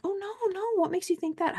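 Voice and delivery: high, polite sing-song denial; high sweet denial. Retold or imitated. Replying to coworkers asking if she's mad, high sweet denial. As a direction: play it innocent and high. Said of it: "I'm like".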